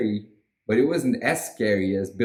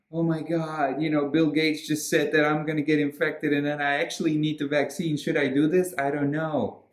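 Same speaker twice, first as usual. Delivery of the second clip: acted fretting, pitched up; worried inner voice; 4 semitones higher than usual. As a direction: dither in a high, anxious put-on voice. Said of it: "element in my head that is just saying".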